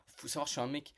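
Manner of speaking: ordinary delivery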